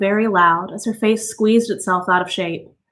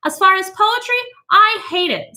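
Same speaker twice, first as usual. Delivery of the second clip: high, scornful society lady voice; high dismissive lady's voice; Mrs Bowles; 11 semitones higher than usual. A narrator's reading. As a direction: sneer at poetry as a shallow housewife.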